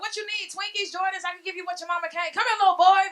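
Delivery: high, coaxing, luring voice building to a loud call; creepy mall lady. Retold or imitated. Comic lures teenage boys at the mall, coaxing then calling out. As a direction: tempt the boy then call him over. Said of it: "I be like".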